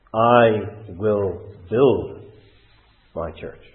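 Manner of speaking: "I will build" drawn out very slowly, weight on "I"; solemn slow stress on "I"; slow solemn quote. Acted. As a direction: let each word land slowly, stressing "I". Quotes Christ's promise, slow.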